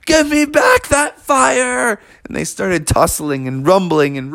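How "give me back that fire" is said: put-on fierce kid bear. Junior charges down the hole.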